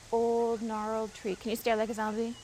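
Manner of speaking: usual speaking voice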